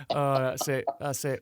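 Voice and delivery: normal talking voice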